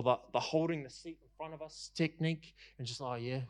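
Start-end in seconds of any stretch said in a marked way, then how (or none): 3.0-3.4 soft, low, uninvested reply; passive, half-hearted. Acted. Churchgoer just clocking it in at worship, half-hearted. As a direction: say it with barely any energy. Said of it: "It's passive worship".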